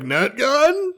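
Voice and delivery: high, eager question; squeaky overexcited asking; excited. Retold or imitated. Armory guy eager for weapon.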